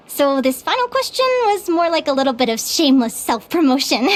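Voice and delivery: usual speaking voice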